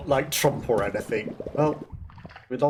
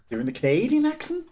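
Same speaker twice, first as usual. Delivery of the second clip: "accent" lifted high at the end like a question; Canadian upward lilt; 9 semitones higher than usual. Shown as a demonstration. Wondering if the American attempt turned Canadian, rising on "accent". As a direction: make "Canadian accent" climb as if asking. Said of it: "it goes up at the end".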